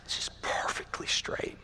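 whispered wonder, barely voiced; awed whisper. Man amazed at his perfectly straight line, awed whisper. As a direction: breathe it out in hushed disbelief.

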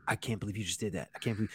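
hushed, appalled aside; wife's disbelief. Retold or imitated. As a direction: imitate a shocked wife under your breath, low and soft. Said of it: "my wife is over there like".

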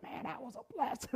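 voiceless, very soft hoarse croak; imitated hoarseness. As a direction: mimic a voice shouted away. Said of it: "I was hoarse".

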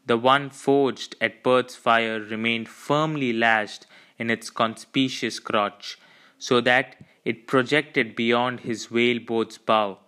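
usual speaking voice

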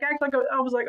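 normal talking voice

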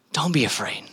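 hushed, almost whispered comfort; low, soft and tender; gentle reassurance. Acted. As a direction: soothe someone frightened, very softly. Jesus reassures the father.

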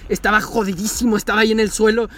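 normal talking voice

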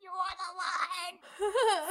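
high, very slow laughing wail; swooning echo. Real, not acted. Reader echoing her friend, high laughing swoon.

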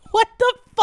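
loud, high-pitched incredulous outburst; retold disbelief. Host retelling her reaction to his interviews, loudly incredulous. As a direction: replay your baffled outburst. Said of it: "And I'm like".

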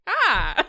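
loud, high mock cry; short hammed-up outburst; Topanga's squawk. Mimicking Topanga in the tense scene, squawking. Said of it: "literally her voice is".

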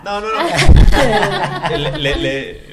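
laughing. Real, not acted. Laughs off host's joke.

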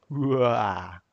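cartoon villain's evil laugh; sinister cackle, mustache twirling. Shown as a demonstration. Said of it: "têm a gargalhada maligna".

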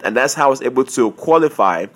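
normal talking voice

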